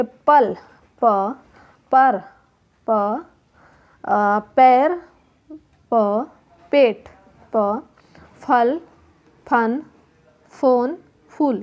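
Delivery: "पल", "पर", "फल" each said singly, with pauses; pronunciation demo. Shown as a demonstration. Teacher showing children initial sounds in "पल" and "फल", pronunciation demo. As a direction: sound out "पल", then "प", as separate clear units. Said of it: "उच्चारण के आधार पर उच्चारण करवाना है".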